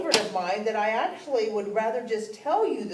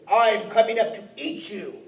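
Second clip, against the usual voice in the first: louder menacing troll; troll threat; 4 semitones higher, 6 dB louder than usual. Acted. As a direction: threaten to eat him. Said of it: "He said".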